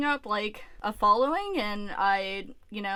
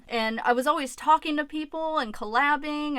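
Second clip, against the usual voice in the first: ordinary delivery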